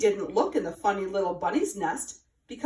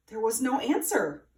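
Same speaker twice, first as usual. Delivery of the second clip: plain reading voice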